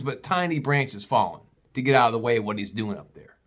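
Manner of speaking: ordinary talk, nothing marked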